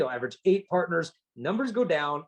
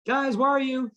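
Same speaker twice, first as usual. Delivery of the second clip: calling out; 10 semitones higher than usual. Retold or imitated. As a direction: call up the stairs for the kids. Father calling for hiding kids.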